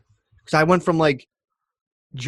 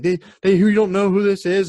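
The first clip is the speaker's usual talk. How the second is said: high, louder, stammered worried plea; imitated scared; 8 semitones higher, 5 dB louder than usual. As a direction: mimic a frightened partner's urgent warning. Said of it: "legitimately scared".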